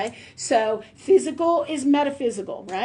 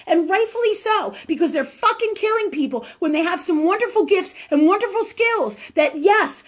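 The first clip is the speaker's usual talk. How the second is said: real anger; 7 semitones higher than usual. Real, not acted. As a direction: let the anger drive every warning. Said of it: "'cause I'm so angry about this".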